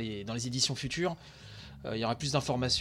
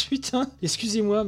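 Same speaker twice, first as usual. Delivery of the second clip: excited swear; 6 semitones higher, 4 dB louder than usual. Real, not acted. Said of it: "je suis un peu grossier".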